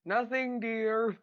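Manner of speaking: high, loud yell back. Acted. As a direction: shout it back through the door. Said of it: "I'm gonna yell back".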